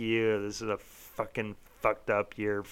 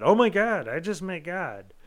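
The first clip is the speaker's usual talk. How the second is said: excited; 7 semitones higher, 5 dB louder than usual. Retold or imitated. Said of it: "that it's truly like".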